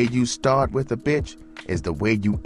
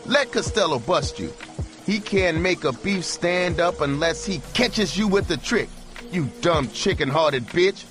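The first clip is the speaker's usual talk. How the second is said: furious holler; 13 semitones higher than usual. A narrator's reading. Pimp hollers at his hooker through the window, furious. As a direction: tear into her with fury. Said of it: "he hollered".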